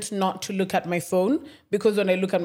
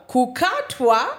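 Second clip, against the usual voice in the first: slow, raised-pitch disbelieving repeat; incredulous echo; 4 semitones higher, 4 dB louder, much slower than usual. Retold or imitated. Tenant echoing caretaker's news of disconnection, incredulous. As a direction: draw it out in disbelief.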